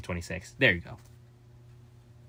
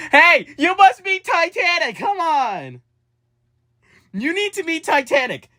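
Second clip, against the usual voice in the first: much higher, louder, slow sing-song welcome; cheery greeter voice; 7 dB louder than usual. Pretend. Greeting a fan as a cheery high-voiced character. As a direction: greet a newcomer like an excited mascot.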